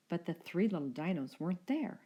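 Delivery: ordinary delivery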